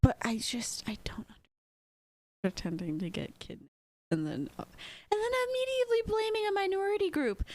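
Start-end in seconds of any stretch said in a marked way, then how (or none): 5.1-7.4 fast, much higher, disbelieving; incredulous. Real, not acted. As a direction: rush it out in disbelief. Incredulous at her blame.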